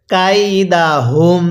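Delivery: very slow "কাইদাহুম", long syllables; model recitation. Shown as a demonstration. Teacher has learners read along, very slow "কাইদাহুম". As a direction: stretch "কাইদাহুম" out, humming the final m. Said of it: "আরেকবার আমার সাথে পড়ুন".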